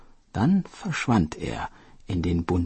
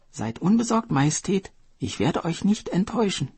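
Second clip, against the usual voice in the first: much higher, earnest reassurance; eager turtle; 10 semitones higher than usual. Acted. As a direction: promise the king boldly.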